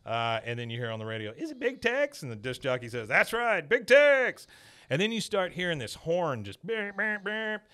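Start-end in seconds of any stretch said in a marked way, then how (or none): 1.4-2.2 much higher, thin voice asking on the radio; radio caller voice. Retold or imitated. A caller on the radio guessing Big Tex, voiced high. 3.1-4.5 high, loud, booming DJ announcement; radio DJ voice. Retold or imitated. The disc jockey confirming the answer on air, loud and high.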